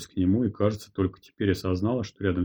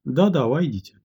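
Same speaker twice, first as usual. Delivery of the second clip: cheerful; 6 semitones higher, 3 dB louder than usual. A narrator's reading. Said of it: "ответили почти весело".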